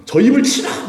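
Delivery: high, loud command to strike; mock outraged priest. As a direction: bark the order in mock fury. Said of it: "막 그러잖아".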